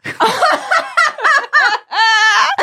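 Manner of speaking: a high, loud burst of delighted laughter. Real, not acted. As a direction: break into squealing laughter. Cohost delighted by wedding vow idea.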